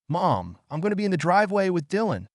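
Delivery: fast, high call to another room. A narrator's reading. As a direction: call out to mom down the hall. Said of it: "pausing at the dining room to call out".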